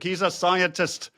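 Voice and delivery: high, lilting mock Welsh accent; sing-song Welsh accent, played for laughs. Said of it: "I'm gonna use my Welsh accent".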